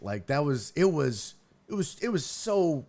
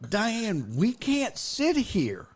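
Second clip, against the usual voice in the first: measured plea; 6 semitones higher, slower than usual. Retold or imitated. Retells first careful plea to aunt.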